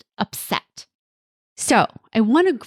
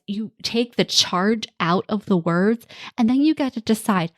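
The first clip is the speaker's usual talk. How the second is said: ordinary talk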